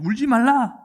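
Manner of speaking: high, loud proclaiming voice; elder's command. Retold or imitated. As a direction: call it out as an elder halting someone's tears. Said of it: "장로 중에 한 사람이 내게 말하되".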